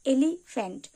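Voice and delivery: "Elephant" in two parts with a gap after "এলি"; broken into syllables. Shown as a demonstration. Teacher repeating the demonstration for learners, "elephant" broken in two. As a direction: split "elephant" into "এলি" and "ফ্যান্ট". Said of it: "দুবারে ভাঙতে হবে".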